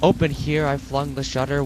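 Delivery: usual speaking voice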